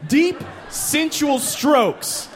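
high, drawn-out sultry instruction; coaching whale. Retold or imitated. Whale coaches Jeff on how Becky likes it, high and slow. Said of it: "you're like".

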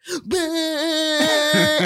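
high, goofy squeal for a silly pose; a hammed-up squeal to entertain her son.

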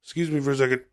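ordinary talk, nothing marked

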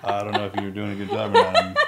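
low, soft, laughing mock of an unbothered instructor; calm mocking instructor. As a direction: instructor taunting a trainee in chaos, calm mocking voice. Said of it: "in the chaos with you right here going".

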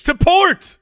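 high, loud mock command; hammed-up skipper's yell; shouting. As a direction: shout a sailing order at the kids. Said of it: "it's just me shouting at my kids".

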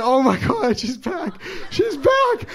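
high, excited cries of joy; overjoyed disbelief. Retold or imitated. Man seeing his barber is back, high and overjoyed. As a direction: gasp it out like the best news of your life. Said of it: "I just keep saying it".

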